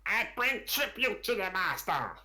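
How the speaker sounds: high creature voice, proud; kobold boasting. Acted. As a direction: announce the tribute in a high lizard voice. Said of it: "And he's like".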